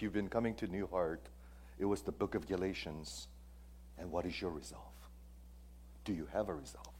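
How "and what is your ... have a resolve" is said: a hushed, intimate whisper to the listeners; whispered question. Real, not acted. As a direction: lean in and whisper the challenge. Preacher quietly presses the congregation.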